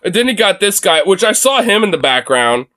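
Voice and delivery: normal talking voice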